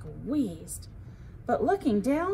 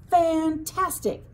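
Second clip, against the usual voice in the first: thrilled; 6 semitones higher, 5 dB louder, slower than usual. A narrator's reading. Thrilled at the thunder.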